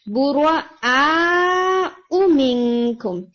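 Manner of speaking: بُرَآءُ with the آ stretched very long, slowly; long madd demo. Shown as a demonstration. Teacher demonstrating the long madd in بُرَآءُ, very slow. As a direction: hold the آ of بُرَآءُ for four or five counts. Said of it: "برا اور پھر آ جو ہے وہ مد ہے".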